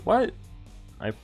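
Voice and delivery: ordinary talk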